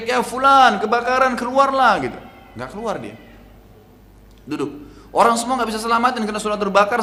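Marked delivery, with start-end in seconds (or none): 0.0-1.9 high, loud call of alarm; raised, urgent warning cry; retold neighbours' shout. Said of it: "Tetangganya semua teriak".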